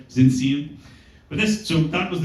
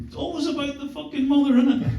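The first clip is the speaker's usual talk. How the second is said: cheeky quip; 10 semitones higher, 3 dB quieter than usual. Retold or imitated. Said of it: "I sort of remarked".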